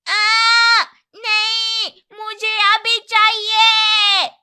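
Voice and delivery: put-on high, loud child tantrum scream; बच्चे की चीख. A narrator's reading. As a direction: wail it out like a spoiled kid. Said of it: "चिल्लाना शुरू किया". (जिद्दी बच्चे की ऊँची चीख)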